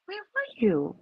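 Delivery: high, worried question; anxious friend asking where she went; lightly voiced worried date; concerned. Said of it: "Like, concerned".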